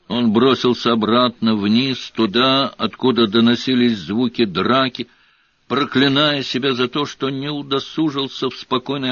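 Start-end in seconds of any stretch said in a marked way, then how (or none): none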